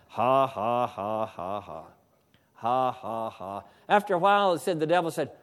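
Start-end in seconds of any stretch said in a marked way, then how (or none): 0.1-3.6 slow, low, joyless mock laughter; forced laugh. Sick man making himself laugh at the devil, forced low laugh. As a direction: drop low and intone each fake "ha". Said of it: "I didn't feel like laughing".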